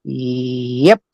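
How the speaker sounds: low, quiet little yep; dropped-down, isolated mock yep; imitated bad comic. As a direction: imitate a lame comic's low tag after a joke. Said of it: "he'd go like this".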